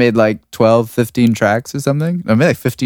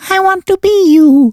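high put-on voice; 6 dB louder than usual. Retold quote in put-on voice.

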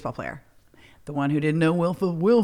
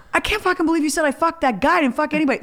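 much higher, indignant outburst; outraged retort; 10 semitones higher, faster than usual. Retold or imitated. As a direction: protest the lie in outrage. Confronting Kathy.

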